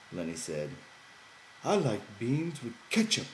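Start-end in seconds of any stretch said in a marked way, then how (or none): 1.6-3.3 put-on higher, childlike simple man; Lenny's voice. A narrator's reading. Lenny promising George he won't talk, higher childlike voice.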